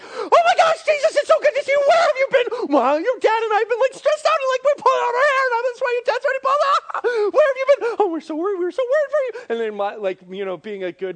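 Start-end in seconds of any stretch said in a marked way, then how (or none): 0.0-9.5 shrieking high, loud, frantic mock mom; hammed-up freak-out, words tumbling out with laughs; mom flip-out. Mary finding her lost son in great distress, hammed-up flip-out. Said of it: "go into, like, the mom flip-out mode".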